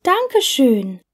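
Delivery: normal talking voice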